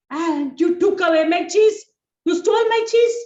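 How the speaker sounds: high, loud furious squeak; angry accusation. Acted. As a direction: shout the accusation at Tom. Jerry accuses Tom of stealing.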